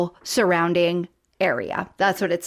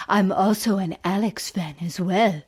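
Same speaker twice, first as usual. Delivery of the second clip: husky voice; 3 semitones lower than usual. Retold or imitated. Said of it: "she has a, a husky voice".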